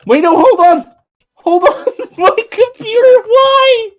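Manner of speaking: high, loud frantic pleading; panicked shouting. Real, not acted. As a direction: yell at a frozen computer in panic. Computer freezes mid-stream.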